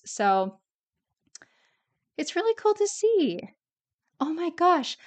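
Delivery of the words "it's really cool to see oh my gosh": much higher, delighted squeal; thrilled, voice jumping up; excited. Real, not acted. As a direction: gush over what you just found, pitched way up. Delighted rereading old journal.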